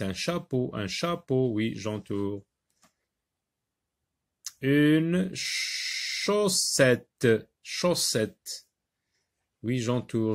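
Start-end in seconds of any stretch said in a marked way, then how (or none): 4.6-8.7 "Une chaussette" stretched very slowly, each sound held; slow drawn-out word. Shown as a demonstration. Teacher slowing the word for pupils to hear ch. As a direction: draw "chaussette" out slowly so the ch is heard. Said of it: "j'entends le son ch".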